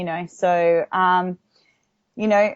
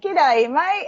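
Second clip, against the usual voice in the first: a hammed-up Aussie G'day, higher; Australian greeting; 8 semitones higher than usual. Actress greets her Australian co-star in mock Aussie. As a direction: greet him in broad Australian. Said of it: "he swears a lot".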